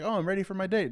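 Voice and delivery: playfully imitating an excited date-goer; mock cheerful. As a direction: sound eager and clueless.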